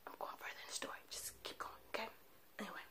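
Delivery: hushed conspiratorial whisper. Real, not acted. Reassuring shocked viewers in a whisper. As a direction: lean in and whisper it. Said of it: "whispering aside to viewers".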